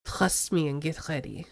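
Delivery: put-on low, soft French accent, purring; smooth French accent crooning at the rookie; a hint of a lazy French accent. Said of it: "made Armando have a French accent".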